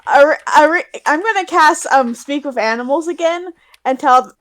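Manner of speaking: ordinary delivery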